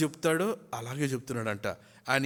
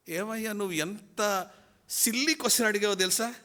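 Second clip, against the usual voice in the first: higher, brash put-down of a silly question; bold, unafraid retort; 7 semitones higher than usual. Retold or imitated. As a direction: toss the answer off with cocky confidence. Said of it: "ఏమాత్రం బెరుకులేదు, జంకలేదు". (ధైర్యంగా, ఎత్తైన స్వరంతో ఎగతాళిగా)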